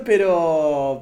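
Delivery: ordinary delivery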